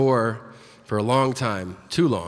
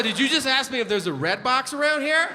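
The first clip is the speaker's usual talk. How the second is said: incredulous; 10 semitones higher than usual. Retold or imitated. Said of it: "I said".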